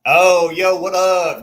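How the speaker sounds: big hearty call-out to a friend; loud greeting. Real, not acted. Host calls out to John joining the stream, loud and glad. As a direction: shout the hello as he joins.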